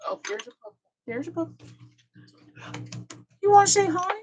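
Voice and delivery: high sing-song pet voice; talking to a dog. Real, not acted. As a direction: coo at the puppy, way up high. Speaker greets dog.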